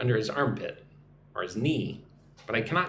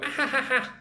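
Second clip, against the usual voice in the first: high mock laugh of someone being tickled; imitated tickled laugh; 11 semitones higher than usual. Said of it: "you tickle somebody, they go".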